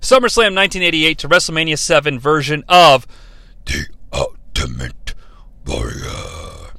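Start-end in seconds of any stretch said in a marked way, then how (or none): 3.6-6.7 much lower, slow, hoarse wrestler growl; Ultimate Warrior voice. Retold or imitated. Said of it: "my poor attempt at an Ultimate Warrior voice".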